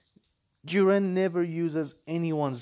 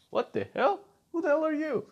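mock outrage; 13 semitones higher, faster than usual. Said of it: "He's gonna be like".

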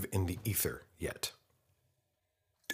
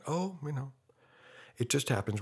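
higher, lightly imitated brush-off; mock dismissiveness; 7 semitones higher than usual. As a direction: shrug it off as someone else would, pitched up. Voices church people explaining away deaths.

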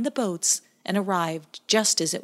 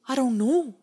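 sounding brave; 6 semitones higher, 3 dB louder than usual. Acted. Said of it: "trying to sound brave".

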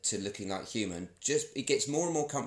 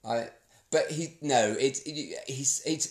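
ordinary delivery

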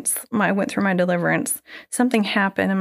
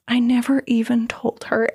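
ordinary talk

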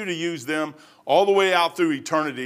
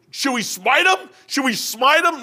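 eager soldier; 9 semitones higher, 4 dB louder than usual. Retold or imitated. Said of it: "one of the guys said".

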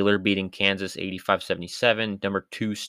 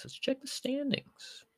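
high slow voice; 8 dB quieter, slower than usual. Pretend. Repeats line in odd voice.

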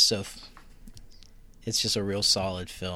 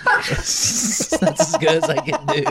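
high, loud, cracking up; laughing; 7 dB louder, slower than usual. Real, not acted. Co-host admitting his impression's limit, laughing hard. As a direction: squeeze it out through helpless laughter.

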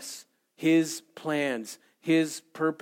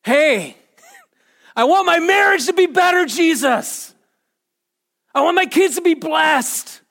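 high, loud pleading shout; impassioned yelling; 10 semitones higher, 7 dB louder than usual. Acted. Believer yelling out to Jesus what they want, impassioned shouting. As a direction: cry out your wants with full force. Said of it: "Are we gonna yell out".

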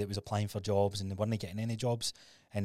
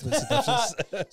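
mock incredulous squeal; 12 semitones higher, 8 dB louder, slower than usual. Real, not acted. Teasing "unemployed as fuck.

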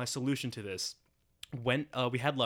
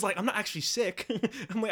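ordinary delivery

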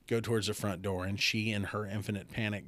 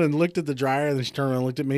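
ordinary talk

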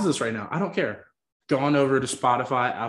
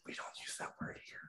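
mock-scandalized whisper; hushed whisper; 23 dB quieter than usual. Co-host correcting a gaffe, hushed whisper.

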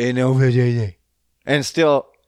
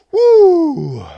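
excited whoop; 9 dB louder than usual. Real, not acted. Hyping himself up before smoking.

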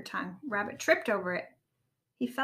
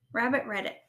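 plain reading voice

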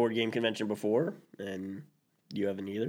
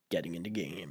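soft, lower show title; quiet title drop; 5 semitones lower, 9 dB quieter than usual. Shown as a demonstration. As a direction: murmur the podcast name. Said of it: "you're listening to".

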